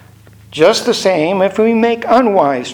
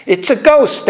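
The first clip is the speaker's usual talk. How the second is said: a raised, fearful cry of the disciples; retold frightened yell; 6 semitones higher than usual. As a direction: call it out like a scared fisherman. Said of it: "they yelled".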